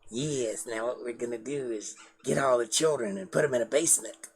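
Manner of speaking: creepy pervert voice, soft and hushed; mock a sinister creep, speaking softly; Richard Dawkins. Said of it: "he sounds like a pervert when he talks".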